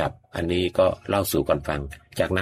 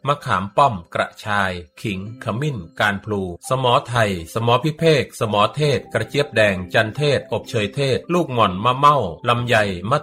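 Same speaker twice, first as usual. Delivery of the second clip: radio ad read; 5 semitones higher than usual. Acted. Radio host reading the mangosteen juice advert, upbeat pitch. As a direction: sell it to the listeners like a radio ad.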